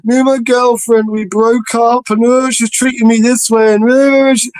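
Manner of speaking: high, whingeing imitation of a heartbroken mate; hammed-up self-pitying friend; whiny mock complaint. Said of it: "sending me messages going".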